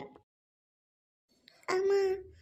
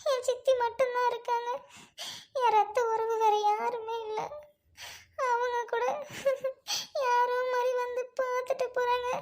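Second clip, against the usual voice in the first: crying through the words, voice slightly raised; tearful lament; 3 semitones higher than usual. Acted. Orphaned girl alone, calling on God and her lost mother, crying. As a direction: sob out a lonely lament between gasps. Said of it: "தனியா நின்னு ஏங்க அழுகுறீங்க?".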